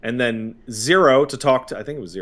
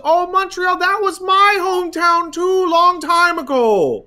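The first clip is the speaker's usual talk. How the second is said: much higher, slow, sing-song lady voice; sweet lady; 5 dB louder than usual. Retold or imitated. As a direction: coo at the dog like a sweet older lady. The lady recalls Montreal.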